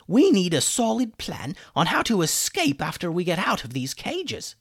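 high, emphatic character voice; high-pitched sceptical wizard; exasperated chicken wizard. A narrator's reading. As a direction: lecture the boys, exasperated, in a high voice.

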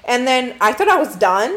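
ordinary talk

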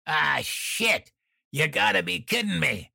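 high, sharp annoyed outburst; bark. A narrator's reading. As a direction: snap it out in irritation. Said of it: "I heard Dan bark".

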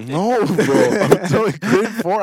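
high, loud, through laughter; laughing protest. Real, not acted. Telling friends about grade four, loud high laughing protest. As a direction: insist while cracking up. Cracking up while defending story.

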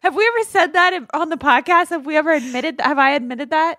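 high, loud, playful protest at the accusation; mock outrage. Cohost teasingly protesting being called intense, in mock outrage. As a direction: squeal it like you're offended but laughing. Friend jokes she is intense.

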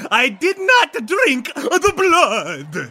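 high, slow, loud vampire voice; hammed-up vampire declaration; vampire proclamation. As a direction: proclaim it slowly to the whole crowd. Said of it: "puts his arm out and says".

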